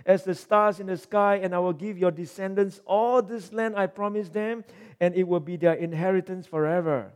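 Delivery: Moses begging God, pitched high and urgent; pleading Moses. Acted. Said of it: "And then verse thirteen".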